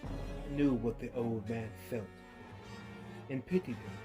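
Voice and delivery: ordinary delivery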